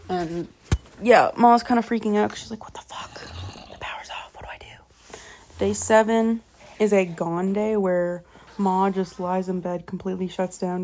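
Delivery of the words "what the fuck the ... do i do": hushed, breathless whisper of a panicking mother; whispered panic. Retold or imitated. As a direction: whisper it like a frightened mom in the dark. Ma panicking, power off.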